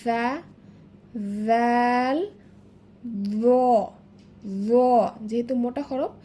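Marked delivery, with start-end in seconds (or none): 0.0-2.2 সা and জাল lisped softly with tongue at the teeth; soft letters. Shown as a demonstration. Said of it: "এটিও নরম করে আমরা উচ্চারণ করেছি". 3.3-4.7 জ as a thick, rounded "dho"; tongue tip at the teeth, mouth full on জ; full-mouthed letter. Shown as a demonstration. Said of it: "একটু গাল ভরে উচ্চারণ করতে হবে".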